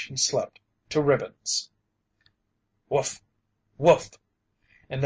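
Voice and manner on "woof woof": a big bear huffing a warning; bear woof. A narrator's reading. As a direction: bear woofs, the second much louder. Bear woofs again.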